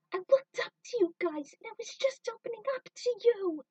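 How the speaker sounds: high, loud and wounded; hurt furious yell. Acted. Trudy shouting at Jet and Faye, hurt and furious. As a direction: cry out the hurt at full volume.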